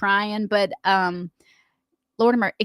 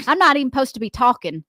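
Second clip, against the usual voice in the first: normal talking voice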